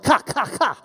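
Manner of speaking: "ka, ka, ka" as separate short syllables; "ka, ka, ka", each a detached sound demo; isolated K sound. Shown as a demonstration. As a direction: repeat "ka, ka, ka" to show the hard K. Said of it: "It's got the ka sound".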